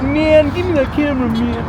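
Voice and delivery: put-on Californian hippie accent. Copying co-host's impression of a camera-grabbing hippie, Californian accent. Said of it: "expect you to do that accent".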